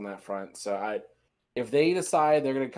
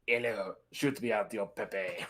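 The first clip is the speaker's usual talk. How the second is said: put-on Pepe the King Prawn accent; 4 semitones lower, 6 dB quieter than usual. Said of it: "now we're doing French accents".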